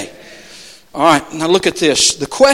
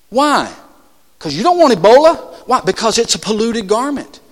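ordinary delivery

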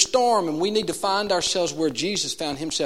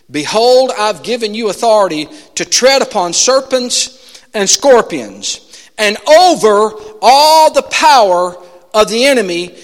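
louder, declaiming scripture read; 6 semitones higher, 9 dB louder than usual. Acted. Preacher reads Luke ten nineteen aloud, proclaiming. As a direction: proclaim the verse with authority.

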